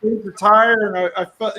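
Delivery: ordinary delivery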